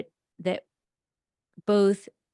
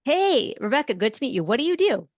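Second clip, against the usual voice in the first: bright, higher, louder party greeting to a new contact; networking stranger; 6 semitones higher, 4 dB louder than usual. Pretend. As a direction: play a friendly stranger opening small talk. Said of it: "let's do it".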